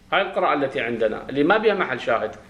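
ordinary delivery

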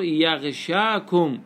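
يغشاكم said yagh-shaa-kum, long 'aa'; long alif. Shown as a demonstration. Teacher repeating the variant reading, يغشاكم as yaghshakum. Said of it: "بالألف".

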